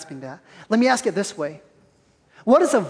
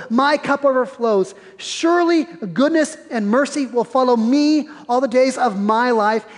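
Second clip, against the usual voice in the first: raised, measured reading of the psalm; scripture reading; 4 semitones higher, 3 dB louder than usual. Acted. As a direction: read the psalm out to the church, lifted and deliberate. Reads Psalm twenty-three aloud.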